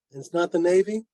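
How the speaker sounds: usual speaking voice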